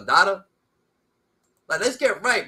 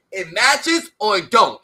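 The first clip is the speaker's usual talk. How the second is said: slow, loud, every word hit; emphatic insistence; 7 dB louder, slower than usual. Real, not acted. Correcting the other host on DNA, slow and loud and emphatic. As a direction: lay down the rule firmly.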